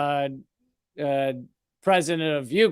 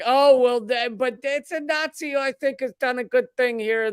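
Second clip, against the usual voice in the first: much higher mock-whiny voice; mock excuse; 8 semitones higher than usual. Mocking someone who defends a Nazi: higher sarcastic voice. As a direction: lightly sneer the excuse in a higher voice.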